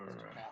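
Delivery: low grumbled "ugh"; short, low, acted grunt of annoyance; grumble. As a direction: grumble under your breath in disgust. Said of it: "grumbles and he goes".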